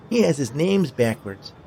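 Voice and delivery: high, slow sing-song taunt; mocking little girl. A narrator's reading. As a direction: stretch it out like a child making fun. Girl laughs at boy's name.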